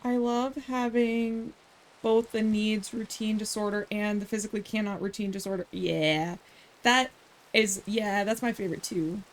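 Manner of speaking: understated ironic praise; sarcastic. Real, not acted. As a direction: say it dryly, as mock enthusiasm. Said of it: "I'm being sarcastic for those who don't".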